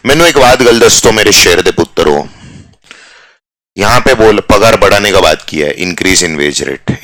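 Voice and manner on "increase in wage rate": slow, soft spelled-out phrase; slow teacher dictation. Shown as a demonstration. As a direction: say increase in wage rate word by word. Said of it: "slow dictation".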